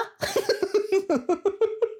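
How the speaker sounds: real, giggling fit at own joke; long high laugh; high-pitched laugh. Real, not acted. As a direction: break into high, wheezy giggles. Laughs at own British impression.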